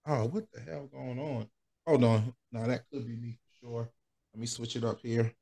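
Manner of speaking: faint, dropping-out mic; bad audio. Real, not acted. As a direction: voice fading through a failing earbud. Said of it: "I need some new AirPods".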